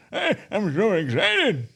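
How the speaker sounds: put-on high, slow old lady voice; grandma voice. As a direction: play a sweet grandma. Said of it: "say it as a grandma".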